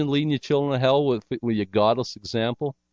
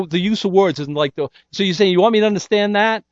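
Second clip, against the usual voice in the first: ordinary talk, nothing marked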